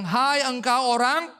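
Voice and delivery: loud, slow, drawn-out rebuke; seruan menegur. Retold or imitated. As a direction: call out a stern reproach slowly. God rebukes the fool, quoted. (teguran lantang dan lambat)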